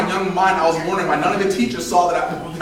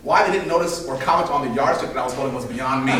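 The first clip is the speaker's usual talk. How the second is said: usual speaking voice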